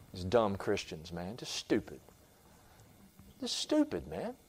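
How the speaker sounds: a quiet sneering devil scoffing at Christians; mocking devil, soft. Devil sneering at foolish Christians, soft and scornful. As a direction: sneer it low and soft, barely above a breath.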